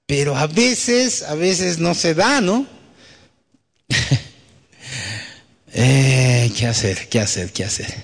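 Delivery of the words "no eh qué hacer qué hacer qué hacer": ordinary delivery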